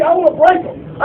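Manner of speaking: ordinary delivery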